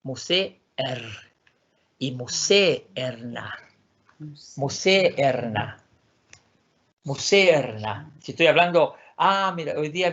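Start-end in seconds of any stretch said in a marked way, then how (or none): 0.0-8.1 museerna slowly, both e's sounded apart; Swedish word. Shown as a demonstration. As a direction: stretch museerna, mu-se-er-na. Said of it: "pronuncie las dos vocales".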